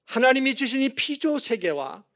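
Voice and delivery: emphatic preaching. Real, not acted. Preacher warning not to be fooled by creation, emphatic preaching.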